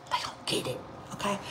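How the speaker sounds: hushed, voiceless repeat; whispered aside. Real, not acted. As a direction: whisper it like a secret. Confiding repeat of the same line.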